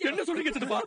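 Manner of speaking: high, stunned question; shocked disbelief. Acted. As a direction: refuse to believe what you just heard. Told of her death.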